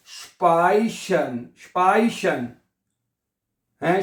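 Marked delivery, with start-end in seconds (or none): 0.1-2.5 स्पाइशन as "shpy-shen", first vowel held long; drawn-out model. Shown as a demonstration. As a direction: teach स्पाइशन slowly in two parts. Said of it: "तो ये बनेगा".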